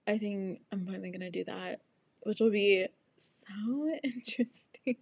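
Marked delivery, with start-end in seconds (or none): 2.2-5.0 whispering. Acted. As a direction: giggly whisper. Unvoiced laughing whisper.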